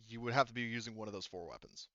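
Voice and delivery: usual speaking voice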